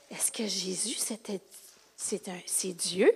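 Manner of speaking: low, soft, near-whispered question; hushed wondering. Acted. Follower asking herself whether Jesus is God, hushed wondering. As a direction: drop to a slow, private hush.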